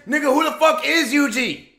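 ordinary talk

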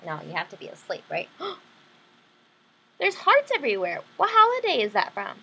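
ordinary delivery

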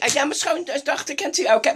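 ordinary talk

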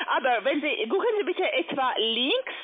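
usual speaking voice

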